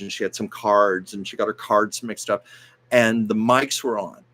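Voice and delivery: normal talking voice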